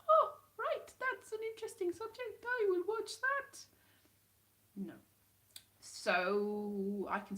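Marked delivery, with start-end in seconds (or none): 0.1-3.6 high-pitched mock enthusiasm; keen interest. Viewer welcomes an interesting programme, high-pitched keen interest. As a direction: perk up high, slowing at the end. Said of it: "normally I'd be like".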